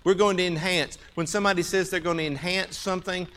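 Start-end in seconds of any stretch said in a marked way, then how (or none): 0.0-1.0 loud. Real, not acted. Preacher stressing point loudly.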